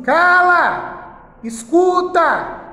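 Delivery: loud, high classroom command; professor mandando calar. Acted. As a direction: order the class like a strict teacher, drawn out and loud. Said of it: "É igual o professor na sala de aula, quando fala assim". (ordem de professor em voz alta, prolongada)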